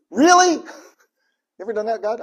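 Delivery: loud, high incredulous "Really?"; exasperated disbelief. Pretend. Believer venting at God, incredulous loud outburst. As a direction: throw the question at God in disbelief.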